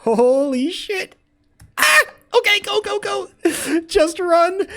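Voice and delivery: high, loud frantic yelling; panicked shouting. Real, not acted. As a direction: shriek in real panic. Panic fleeing guards in game.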